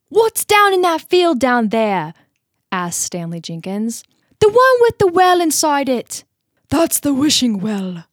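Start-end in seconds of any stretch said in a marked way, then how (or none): 0.1-2.1 high, loud, slow English schoolboy; English accent slipping toward Irish, pitched up; attempted English accent. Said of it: "like, an English accent". 4.4-8.0 loud, high-pitched Irish-sounding farmer; hammed-up English-Irish accent, projected loudly; English accent turning Irish. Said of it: "sounded a little bit more like Irish".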